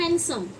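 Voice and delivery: „हैंडसम” as HAN-sum; silent d. Shown as a demonstration. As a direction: say हैंडसम with n straight into s, no d. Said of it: "ये डी silent होता है".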